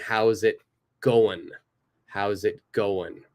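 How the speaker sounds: "going" said as "goin"; "How's it going" with the final G dropped; casual "How's it goin". Shown as a demonstration. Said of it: "I don't say the G".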